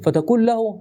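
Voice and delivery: usual speaking voice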